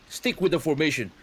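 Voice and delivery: high, loud battlefield orders; commanding officer. Acted. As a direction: bark orders to the squad. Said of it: "Ikar says to you guys".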